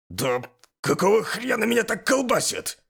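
high-pitched indignant outburst; loud indignation. A narrator's reading. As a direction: protest loudly in exasperation. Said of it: "Начал я громко негодовать". (громко негодуя)

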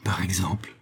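astonished murmur under the breath. A narrator's reading. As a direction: breathe it out in disbelief. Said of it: "murmura-t-il".

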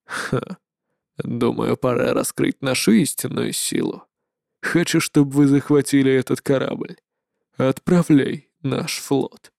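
low, soft menacing command; cold dark overlord; shadow lord. Acted.

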